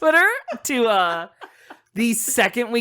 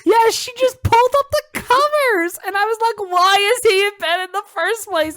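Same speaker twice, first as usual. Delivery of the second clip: high squeaky excited retelling; 5 dB louder than usual. Retold or imitated. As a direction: tell it in a shrill-high excited pitch.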